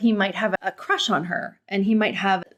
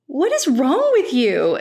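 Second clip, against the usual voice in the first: higher, louder disbelief; exasperated; 5 semitones higher, 4 dB louder than usual. Real, not acted. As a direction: call out her cruelty. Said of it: "I'm just like".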